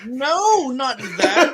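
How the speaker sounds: loud, high-pitched objection; exasperated protest. Real, not acted. Co-host correcting the host's title emphasis, loud exasperated protest.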